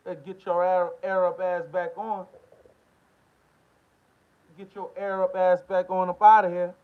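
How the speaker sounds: loud, heated order to clear out; angry dismissal. Real, not acted. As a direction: send them packing, forcefully and loudly. Angrily ordering opponents to leave.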